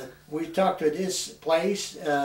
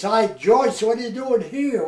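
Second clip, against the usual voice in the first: higher and louder, a happy surprised hail; excited greeting; 7 semitones higher, 6 dB louder than usual. Retold or imitated. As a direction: call out gladly to a long-gone relative. Brother-in-law greets surprise visitor.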